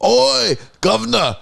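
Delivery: high, hammed-up Cockney "Oi, governor"; Cockney accent. Friend asked for a British accent, hammed-up high Cockney. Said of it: "can you do a British accent?".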